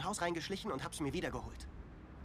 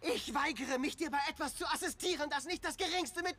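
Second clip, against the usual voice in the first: angry outburst; 7 semitones higher than usual. Acted. Angry refusal, insult.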